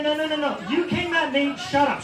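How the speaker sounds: usual speaking voice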